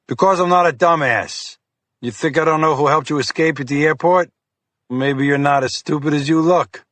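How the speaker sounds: brusque agent trading insults; mocking FBI agent. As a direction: needle him with contempt. Fiore insults detective.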